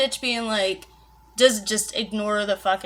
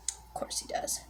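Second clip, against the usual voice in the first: whispered aside; 12 dB quieter than usual. Real, not acted. Sarcastic unvoiced aside.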